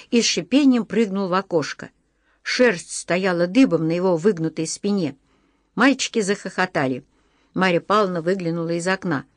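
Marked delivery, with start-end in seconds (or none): none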